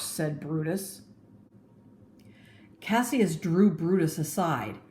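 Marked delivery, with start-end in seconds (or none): none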